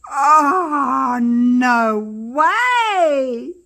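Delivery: loud, breathless cry of delight; amazed. Real, not acted. First sight of unmolded piece.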